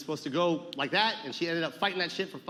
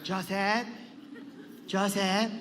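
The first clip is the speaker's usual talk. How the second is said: lightly voiced accented grandmother; Lola Tinay; 4 dB quieter, much slower than usual. Grandmother calls her grandson in the car, lightly acted. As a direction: call out as a grandmother, lightly put on. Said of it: "and she'd be like".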